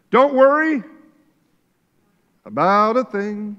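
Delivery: proclaimed verse. Acted. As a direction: announce it to the room. Reading verse loudly.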